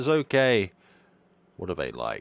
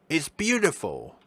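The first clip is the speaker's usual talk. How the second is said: higher, delighted doctor voice; raised-pitch doctor admiring the room; doctor character voice; 8 semitones higher than usual. Acted. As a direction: gush about it like the genteel doctor. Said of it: "I'm gonna drop this voice".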